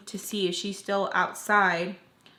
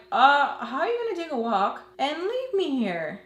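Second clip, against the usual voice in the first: higher, indignant mock outrage at her leaving; retold angry rant, pitched up; pissed-off rant; 6 semitones higher, 3 dB louder than usual. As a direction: squawk it up high like you can't believe she left you. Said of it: "I'm pissed off 'cause I'm like".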